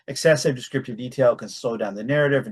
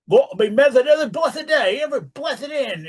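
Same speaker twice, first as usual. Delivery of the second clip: high put-on British country accent; old villager with a rural British accent; 5 dB louder than usual. Shown as a demonstration. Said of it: "country accent he's supposed to have".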